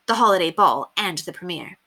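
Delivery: ordinary delivery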